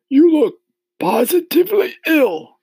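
put-on loud, high old grasshopper voice. A narrator's reading. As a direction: exclaim as a storybook old insect. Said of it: "the old green grasshopper asked".

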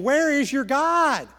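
scornful challenge from an enemy; mocking. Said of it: "They're mocking me".

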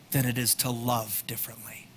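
whispered punchline; soft unvoiced close of the sentence. Real, not acted. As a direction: lean in and whisper it. Contrast of acting and loving.

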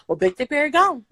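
ordinary talk

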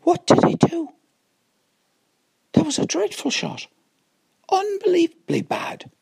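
high, loud shocked disbelief; shocked outburst. Real, not acted. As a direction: cry out in disbelief at the shot. Tiger hits dreadful shot.

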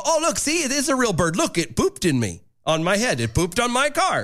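lightly hammed-up wonder at a discovery; mock amazement. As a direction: act out a gullible, amazed onlooker. Acting out a fooled bird believer.

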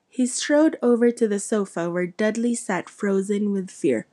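ordinary delivery

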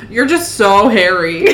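put-on loud, slow, piping Capitol accent with a laugh; silly Capitol accent. A narrator's reading. Venia remarking on a hairy client, silly Capitol accent. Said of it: "pipes in her silly capital accent".

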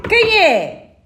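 high, loud gruff wolf; big bad wolf voice. A narrator's reading. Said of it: "perguntou o lobo mau".

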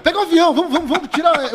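high, loud, laughing rush; mock the tourists rushing about; hurried tourist. Mocking rushed tourists in Europe.

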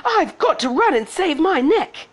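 put-on high, panicked girlish cry; high-pitched flustered shout; shouted alarm. A narrator's reading. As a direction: exclaim it in a high voice, in a hurry. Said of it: "She shouted".

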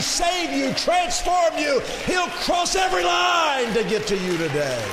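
high, loud shouted preaching; full-force preaching. Real, not acted. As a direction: whoop it out to the church, building.

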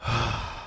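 short heavy sigh of giving in; resigned sigh. Retold or imitated. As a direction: sigh like it's a chore. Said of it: "That exact tone".

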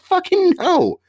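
loud, high-pitched mock outburst; exasperated refusal. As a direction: imitate a fed-up worker exploding at a customer. Fed-up worker refusing, retold.

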